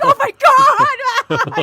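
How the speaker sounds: high, loud, slow laughing cry; laughing shout. Retold or imitated. Stuntman reliving the explosion, laughing shout. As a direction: let the laugh burst through.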